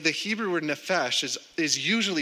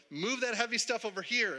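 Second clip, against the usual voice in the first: high, lightly mimicked foreman; mock ordering-around, pitched up without shouting; bossy order; 8 semitones higher, 5 dB quieter than usual. Boss directing laborer on site, mock bossy order.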